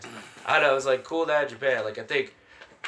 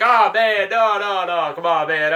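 loud, raised-pitch pleading; acted-out protest; 7 semitones higher, 11 dB louder, slower than usual. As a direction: plead loudly, acting out the protest. Acting out his protest to girlfriend.

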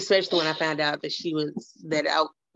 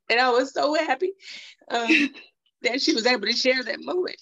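higher-pitched, real tearful voice; choked-up, proud mother; fighting back tears; 8 semitones higher than usual. Real, not acted. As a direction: talk through welling tears. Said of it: "I'm trying not to cry myself".

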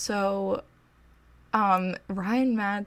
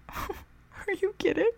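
stunned disbelief; 12 semitones higher, slower than usual. Retold or imitated. Disbelief as Lidge allows photo.

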